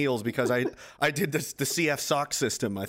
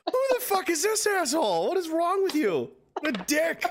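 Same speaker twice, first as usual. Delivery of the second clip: mock outrage; 14 semitones higher than usual. Retelling his outraged reaction.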